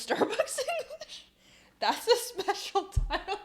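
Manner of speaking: breaking up into high laughter; squeaky laugh taking over the words. Real, not acted. Laughing at her own joke.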